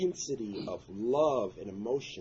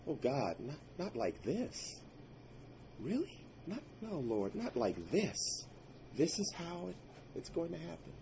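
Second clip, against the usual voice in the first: stunned plea; 7 semitones higher, 4 dB quieter than usual. Retold or imitated. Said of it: "the only thing I could say to myself was".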